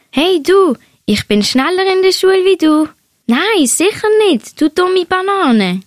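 put-on high, playful kid voices; teasing schoolkids. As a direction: tease like children racing to school. Two classmates teasing on bikes.

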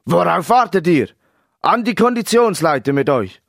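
loud, high, barked orders; impatient coach's shout; snapping coach. A narrator's reading. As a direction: bark at the players to get moving. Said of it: "Der Trainer schnauzte seine Spieler an".

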